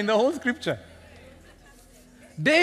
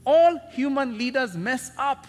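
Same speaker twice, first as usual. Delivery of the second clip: full-force preaching; 7 semitones higher, 6 dB louder than usual. Real, not acted. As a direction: drive it home to the congregation.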